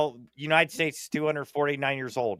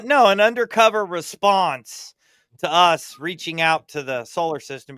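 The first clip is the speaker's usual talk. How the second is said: ordinary talk, nothing marked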